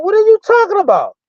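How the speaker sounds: high-pitched what-are-you-talking-about; shocked disbelief. Retold or imitated. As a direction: replay your stunned reaction to the friend. Retelling his shocked reply to Rennie.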